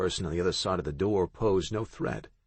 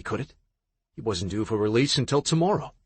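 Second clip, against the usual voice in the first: plain reading voice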